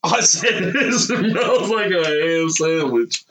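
slow words breaking into laughter; laughing through the repeat; real laughing. Real, not acted. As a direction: crack up mid-sentence. Repeats remark while cracking up.

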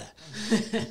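breathy laugh. Real, not acted. Laughs at the joke.

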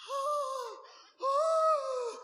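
high, loud imitated child's wail; second-grader's crying fit, imitated high and loud. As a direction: wail like a crying kid working up a tantrum. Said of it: "And you know how children go".

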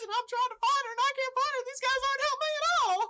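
very high, panicky gnome voice; freaking-out gnome. Acted. Gnome freaking out because nobody helps find her, high and panicky. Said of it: "I'm just sort of freaking out".

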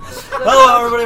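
booming host greeting; loud announcer hello. Acted. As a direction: belt out a big showman hello. Mock intro.

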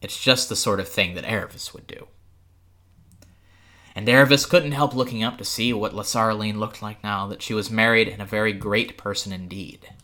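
ordinary delivery

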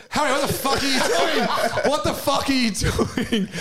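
high-pitched mock alarm, laughing; retold shocked outburst. Woken housemate confronts Harry about to piss, mock shocked. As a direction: imitate a startled housemate, voice shooting up. Housemate wakes to find him.

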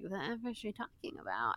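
hushed baffled aside; muttered aside. Retold or imitated. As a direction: mutter it in disbelief.

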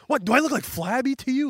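high, hammed-up indignation; indignant retort. Buggy reacting to his mangled name, high indignant retort. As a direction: fire it back as if insulted.

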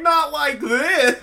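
slow, high, loud laughing cry. Retold or imitated. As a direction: howl it through laughter. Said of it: "I'm like".

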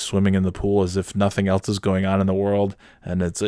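usual speaking voice

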